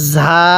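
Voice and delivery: ঝা as a buzzing z with long aa; correct ZAA reading. Shown as a demonstration. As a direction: hold ঝা as a drawn-out "zaa". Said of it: "এরকমভাবে উচ্চারণ হবে".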